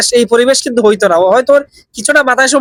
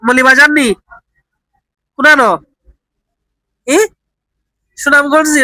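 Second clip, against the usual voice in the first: Sylheti Bengali questions, raised and patient; Sylheti dialect; 3 semitones higher than usual. Shown as a demonstration. To a confused old man, questioning him in Sylheti dialect. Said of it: "Sylheti ভাষা try করে দেখি".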